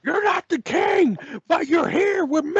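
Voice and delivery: J R impression. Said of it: "I just imagine J R".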